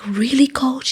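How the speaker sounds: high, breathy whispered "Really, Coach?"; awed, half-whispered question; a stunned athlete asking her coach; hushed disbelief. Retold or imitated. She reacts to good news.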